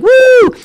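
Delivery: very high, loud whoop; crowd woo. Retold or imitated. Everybody in the bar welcoming them, whooping. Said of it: "everybody's like".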